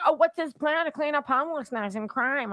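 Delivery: a hint of a prissy activist; mock activist. Host imitating activist asking about the plan, high mock. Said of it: "Lee McGowan was wondering".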